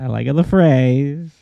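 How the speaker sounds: slow mock Kazakh accent. Co-host echoes host's approval, drawn-out Kazakh accent. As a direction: stretch each word with a comic Kazakh accent.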